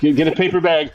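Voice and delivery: ordinary talk, nothing marked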